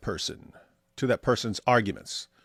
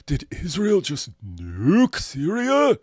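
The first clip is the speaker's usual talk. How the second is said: slow, slightly higher mock quote; imitating Syrian girl; 5 semitones higher, slower than usual. Mocking Syrian girl's posts, slow imitation. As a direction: drawl it like a mocked poster. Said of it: "Syrian girl would post".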